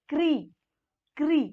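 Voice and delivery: 'क्री' as one emphatic syllable, kree; demonstrated syllable. Shown as a demonstration. Teacher modelling the sound for learners, 'क्री' said firmly, twice. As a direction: say 'क्री' separately and firmly, kr running into ee. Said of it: "इसका उच्चारण हो जाएगा".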